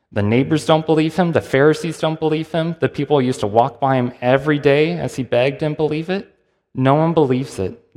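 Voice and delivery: ordinary talk, nothing marked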